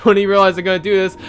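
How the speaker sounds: ordinary delivery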